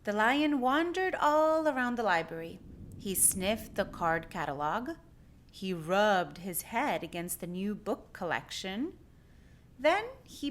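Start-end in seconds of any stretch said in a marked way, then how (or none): none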